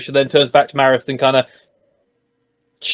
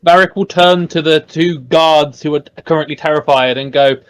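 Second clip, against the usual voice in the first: ordinary delivery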